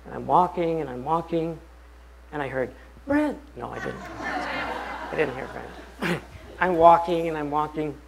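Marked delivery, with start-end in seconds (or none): 3.1-3.4 mock call. Teasing fake call of name.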